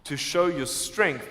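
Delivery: usual speaking voice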